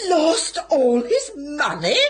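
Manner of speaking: high, slow, aghast question; dismayed disbelief at the ruin. Acted.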